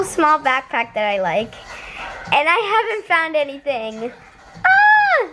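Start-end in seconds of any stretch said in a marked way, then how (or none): 4.6-5.3 short high frustrated shriek; frustrated high cry. Real, not acted. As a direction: let out a sharp exasperated cry. Frustrated after long search.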